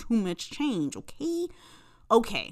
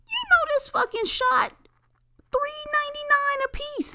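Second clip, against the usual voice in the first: much higher squeaky mock voice, last words whispered; high mock inner voice. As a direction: play your own greedy inner voice, high and sly.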